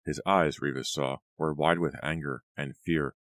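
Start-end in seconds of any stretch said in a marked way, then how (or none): none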